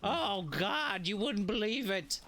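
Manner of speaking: hammed-up pitch about worms and poop; mock earnest storyteller. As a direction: spin the gross tale like a solemn expert. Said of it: "I have to stop".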